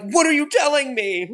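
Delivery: high, aghast mock outrage; imitating a shocked customer who's been fooled; upset disbelief. Streamer mimicking customers upset about horse meat, aghast. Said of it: "they get really upset and they're like".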